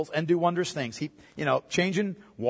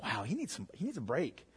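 much softer, lower worried aside; concerned murmur; 9 semitones lower, 15 dB quieter than usual. Retold or imitated. Disciples worrying Jesus needs rest, hushed concern. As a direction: fret quietly over him. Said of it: "Maybe they're just like".

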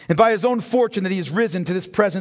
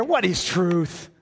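smug, careless; 2 semitones lower, 3 dB quieter, much slower than usual. Retold or imitated. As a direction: toss it off like it doesn't matter. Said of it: "smugly and without care".

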